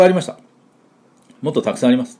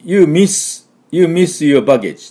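higher, halting imitation of accented English; quoting foreigner's broken English; 7 semitones higher, 5 dB louder, much slower than usual. Teacher retelling what a local said to him about luggage, imitated broken English. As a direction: mimic broken English, pitched up and slow. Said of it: "向こう言ってきたのはね".

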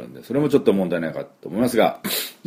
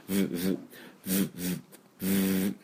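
buzzing V demo; 11 dB quieter than usual. Shown as a demonstration. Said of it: "振動させないとF".

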